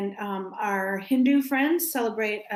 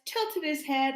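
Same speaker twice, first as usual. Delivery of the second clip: ordinary delivery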